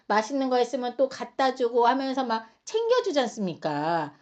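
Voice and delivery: ordinary talk, nothing marked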